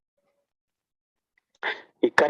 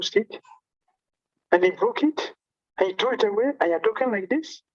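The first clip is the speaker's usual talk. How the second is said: retold stern scolding, pitched higher; angry rebuke; 7 semitones higher than usual. Chaitanya scolding Nityananda over the broken danda, angry rebuke. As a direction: scold him with outward indignation. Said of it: "externally very angry".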